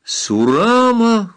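high, loud, long-drawn name call; startled exclamation. A narrator's reading. Two men recognise the girl running in, exclaiming. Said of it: "воскликнули Каммамури и Самбельонг".

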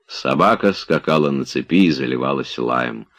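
ordinary delivery

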